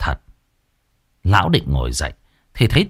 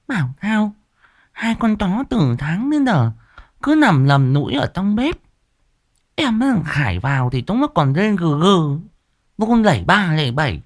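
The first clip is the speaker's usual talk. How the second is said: much higher, sharp scolding wife voice; nagging old wife; 10 semitones higher than usual. A narrator's reading. Wife scolds husband for his fright, higher nagging voice. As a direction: fuss and scold like a village wife.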